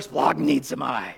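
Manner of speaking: low, slow guttural utterance. Shown as a demonstration. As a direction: drop it deep down and make it guttural. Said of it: "kind of a guttural word".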